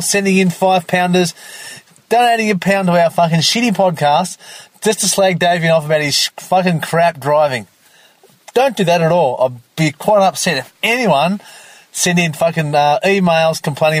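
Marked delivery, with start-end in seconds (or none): none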